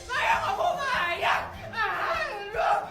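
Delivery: very high, wailing sobs; shrieking, tearful tantrum; crying. Acted. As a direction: bawl like a humiliated spoiled child. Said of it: "Itu anakku kenapa nangis?".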